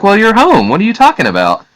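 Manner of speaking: high-pitched woman's voice; puzzled woman. Acted. Woman to a man who asks where he is, high puzzled voice. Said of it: "a voice from a woman say".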